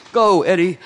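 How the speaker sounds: loud, high cheer; root for him out loud; a rally cheer for Eddie. Pretend. Cheering on Edward Snowden.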